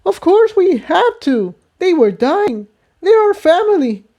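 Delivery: high, shocked protest. Acted. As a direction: mare in disbelief at his claim. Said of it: "Tiptoe was shocked".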